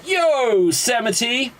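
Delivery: high, hammed-up call back; mock hailing. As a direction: hail high to sell the pun. Ranger hailing back in joke.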